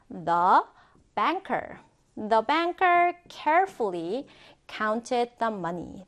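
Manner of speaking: slow, careful dictation in chunks; phrase by phrase. Shown as a demonstration. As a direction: model it slowly, pausing before "counted". Teacher models sentence in chunks.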